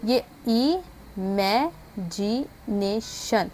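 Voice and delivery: "इमेजिनेशन" stretched out very slowly syllable by syllable; slow pronunciation demo. Shown as a demonstration. Teacher showing students how "इमेजिनेशन" sounds, very slowly. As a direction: spell out "इमेजिनेशन" with your voice, slowly. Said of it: "pronunciation बताई थी वैसे देखें".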